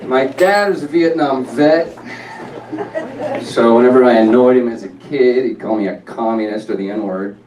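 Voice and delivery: loud, punchy comic delivery; stand-up delivery. Acted. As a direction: sell the joke to a crowd. Comedy bit played or performed.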